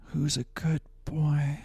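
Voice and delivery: slow breathy whisper to the dog. Shown as a demonstration. Viewer paid for it, so he whispers slowly to the dog. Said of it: "I can do it again".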